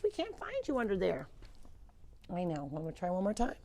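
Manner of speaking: ordinary talk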